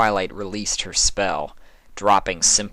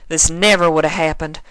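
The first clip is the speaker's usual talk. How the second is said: higher, louder guilty outburst; Applejack, self-blaming; 8 semitones higher, 5 dB louder than usual. A narrator's reading. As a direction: farm mare blaming herself hard. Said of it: "Applejack stamped a hoof".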